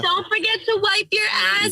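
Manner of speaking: high put-on mothering voice; mock a mom's embarrassing reminder; imitated nagging mom. Co-host teasing him as his mom, high mock nagging.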